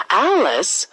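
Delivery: ordinary delivery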